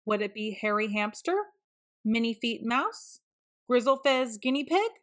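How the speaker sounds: ordinary delivery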